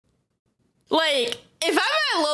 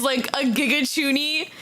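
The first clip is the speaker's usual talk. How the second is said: usual speaking voice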